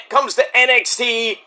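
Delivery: usual speaking voice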